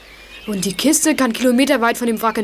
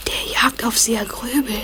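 whisper. Acted. Boy hiding in the moor whispers to friends. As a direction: keep it to a breath.

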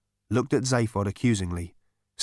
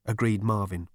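ordinary delivery, nothing marked

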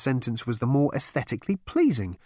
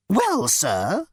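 high, curt bug voice; snapping insect; 8 semitones higher, 4 dB louder than usual. Acted. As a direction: snap back primly. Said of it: "snapped the fragile little creature".

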